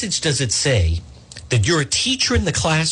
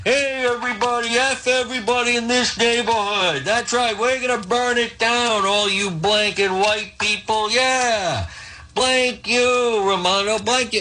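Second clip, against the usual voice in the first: higher, jeering bullhorn protester; mock protester yelling; 7 semitones higher than usual. Host mimics a protester taunting the governor's neighborhood, higher jeering mock yell. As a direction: sneer it like a crowd agitator taunting the neighborhood. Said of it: "screaming for ninety minutes".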